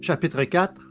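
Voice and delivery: ordinary delivery, nothing marked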